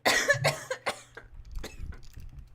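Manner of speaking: long harsh coughing; coughing fit. Real, not acted. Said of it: "I'm coughing a lot".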